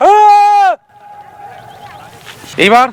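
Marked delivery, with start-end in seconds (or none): 0.1-0.7 long high yell to test the echo; loud shout. Real, not acted. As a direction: belt it out at full volume. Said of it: "একটু চেঁচাও দেখি জোরে".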